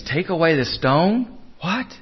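rising, disbelieving echo; incredulous question. Acted. Mourners balk at moving the stone, incredulous. Acting out hearers' disbelief at the order.

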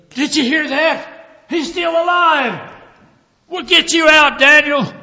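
very high, loud cry of relief; excited shouting. Acted. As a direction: shout it down into the pit. King's side shouting to Daniel.

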